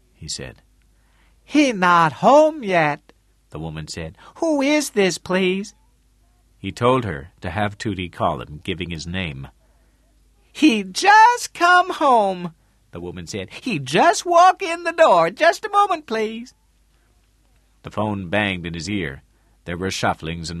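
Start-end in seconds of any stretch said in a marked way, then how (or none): 1.4-3.1 very high, slow, loud woman's voice; woman on phone. A narrator's reading. Woman answering Tootie's phone. 4.4-5.7 high-pitched phone answerer; woman on phone. A narrator's reading. 10.5-12.5 very high, slow, loud woman's voice; woman on phone. A narrator's reading. Woman answering Tootie's phone. 13.6-16.5 very high, loud woman's voice; woman on phone. A narrator's reading. Woman answering Tootie's phone.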